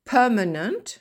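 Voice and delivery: Permanent loud, stress on PER. Shown as a demonstration. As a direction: hit PER first in Permanent. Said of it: "l'accent tonique en première syllabe". (accent tonique sur PER dans Permanent)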